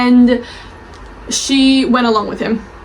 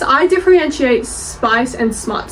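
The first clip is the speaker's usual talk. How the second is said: ordinary talk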